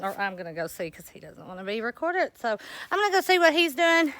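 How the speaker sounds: ordinary talk, nothing marked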